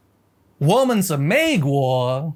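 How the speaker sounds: slow Mandarin "我们是美国" said with flat English intonation, no real tones; American-accented Chinese. Retold or imitated. Imitating the American guy in the video, slow American-accented Chinese. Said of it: "your typical American accented Chinese".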